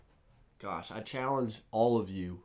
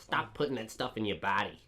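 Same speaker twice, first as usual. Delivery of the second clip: scolding nana. Retold or imitated. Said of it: "that was my nana".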